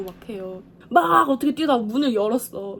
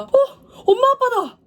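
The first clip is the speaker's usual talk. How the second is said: much higher, louder delighted cry; childlike yelp of recognition; excited shout; 13 semitones higher, 8 dB louder than usual. Retold or imitated. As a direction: call out to parents in happy surprise. Surprised to see parents.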